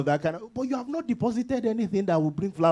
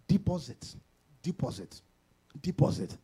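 fading repetition; 4 semitones lower, 7 dB quieter than usual. Acted.

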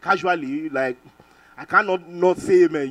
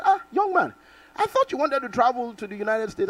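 voicing the overseer; 6 semitones higher than usual. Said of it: "he saw me and said".

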